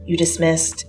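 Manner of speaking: ordinary delivery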